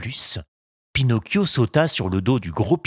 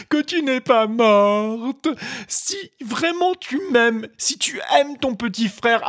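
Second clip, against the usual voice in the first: put-on high child's voice wailing over a grave; desperate little puppet mourning his fairy; heartbroken lament. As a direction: pour out grief, pleading and sobbing. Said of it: "cris stridents et de ses lamentations déchirantes".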